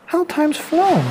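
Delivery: put-on old friends welcoming her home; fond snail flock. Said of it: "who said".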